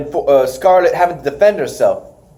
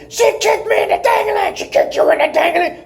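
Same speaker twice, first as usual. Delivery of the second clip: imitating a screaming wrestler, pitched much higher; hammed-up high yell at his partner; mock scream. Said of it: "one of them is screaming".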